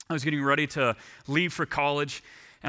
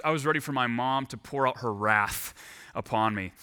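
usual speaking voice

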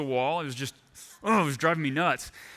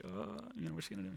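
anxious hush; 5 semitones higher, 16 dB quieter, faster than usual. Retold or imitated. Waiting for mom's verdict.

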